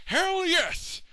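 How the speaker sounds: very high, loud whoop; triumphant shout. Acted. President cheering his plan, triumphant. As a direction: whoop at your own brilliant plan.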